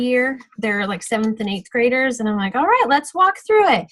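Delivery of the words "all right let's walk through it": put-on higher, louder rallying call to kids; rally the kids to follow along; upbeat instructor. Walking kids through the order form.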